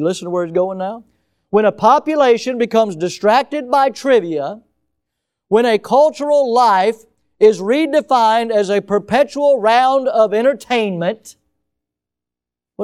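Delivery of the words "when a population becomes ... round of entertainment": dramatic quote reading. Acted. Rereading the quote.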